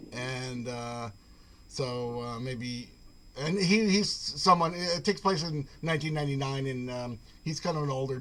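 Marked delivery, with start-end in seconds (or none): none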